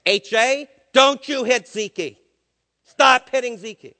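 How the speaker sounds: put-on slow loud scolding of a toddler; retold father's yell. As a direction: bark it like a dad stopping a fight. Father yelling at his son.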